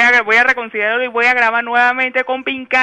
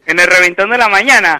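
announcer call; 3 semitones lower, 6 dB louder than usual. Acted. Host announces show segment.

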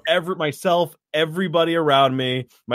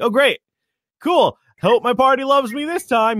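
sarcastic; 9 semitones higher, 4 dB louder than usual. Acted. Sarcastic reply to the DM.

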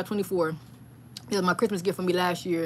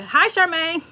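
friendly store clerk; 9 semitones higher, 6 dB louder, slower than usual. Retold or imitated. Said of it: "the lady in Hobby Lobby was like".

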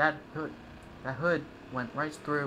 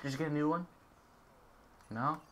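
normal talking voice

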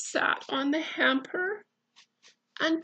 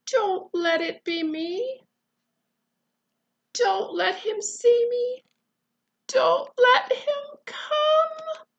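pleading, building from high to louder, higher wail; whimper, growing more desperate with each plea; 10 semitones higher, 9 dB louder than usual. Acted. Eddie begging not to be found by the bear, whimpered. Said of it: "whimpered Eddie".

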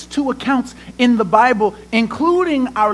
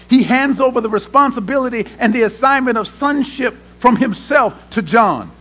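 ordinary delivery

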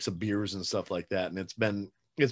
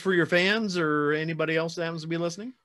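upbeat wrap-up; 8 semitones higher, 6 dB louder than usual. Real, not acted. Host wraps up the interview.

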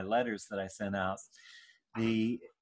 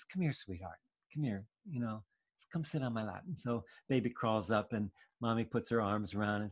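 much softer, tender, calming voice; soothing mother; 6 dB quieter, faster than usual. Retold or imitated. Mother calling her scared baby to her lap, soft and tender. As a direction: gently coax the child onto your lap.

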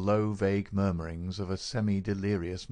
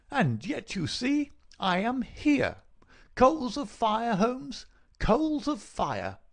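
high, mocking villain's voice; gloating visitor; 14 semitones higher, slower than usual. Visitor lords it over sick man, gloating. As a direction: relish it, drawing out key words slowly.

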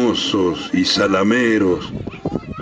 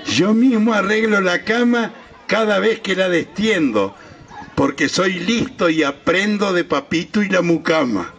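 a lightly voiced little boy, higher and boastful; proud little boy; 8 semitones higher than usual. A narrator's reading. Little boy boasting to his mother, lightly voiced child. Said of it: "gritó el gurí".

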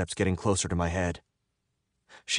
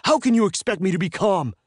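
high-pitched exasperated outburst; outraged yell; 11 semitones higher, 4 dB louder than usual. A narrator's reading. Captive told to calm down, yelling back outraged.

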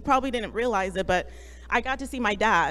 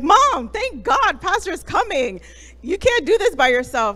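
put-on excited kids cheering; 6 semitones higher, 7 dB louder than usual. Sons cheering to their mom after the call, high and excited. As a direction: voice the sons cheering that help is coming.

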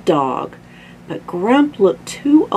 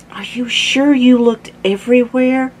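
higher-pitched searching question; lightly voiced concerned mom; 7 semitones higher, 4 dB louder than usual. A narrator's reading.

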